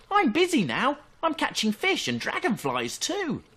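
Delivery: very high, loud squeak of a voice; squeaky otter. Acted. Otter brushing Fox off, squeaky and high. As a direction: squeak it out busily. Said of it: "But Otter squeaked".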